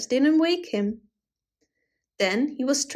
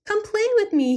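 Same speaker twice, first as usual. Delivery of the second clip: cried out; 10 semitones higher than usual. A narrator's reading. Goblin calling to the cold birds, high and eager. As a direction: coax them to come and play. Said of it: "he cried".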